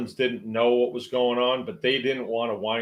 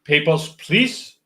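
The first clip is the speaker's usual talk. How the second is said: hammed-up German accent, higher and louder, Danke rising; mock German officer; 5 semitones higher, 7 dB louder, slower than usual. Movie German officer thanking then demanding papers, mock German accent. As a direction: play the film Nazi officer in a mock German accent, slow on Papers, please. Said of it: "throw a danke in at the end".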